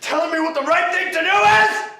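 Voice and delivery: loud, high, dragged-out yells; furious shouting. Acted. Son yells at the father who threw him out, furious. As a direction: rage at him for still being alive.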